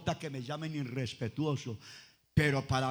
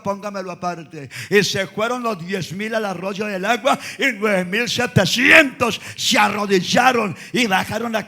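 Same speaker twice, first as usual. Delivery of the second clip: lively storytelling preacher; preaching; 12 dB louder than usual. Acted. Preacher telling the congregation about Gideon, preaching. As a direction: tell the Bible story with fire.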